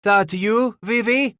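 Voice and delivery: high, loud scream rising on Vivi. Acted. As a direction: scream it out from hiding, pitch climbing. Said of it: "screamed the voice from within".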